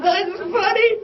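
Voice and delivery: imitating dad's high-pitched helpless laughter; dying laughing. Dad falls over at his son's proposal, high laughing voice. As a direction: squeeze the words out through mock hysterics. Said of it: "He died laughing".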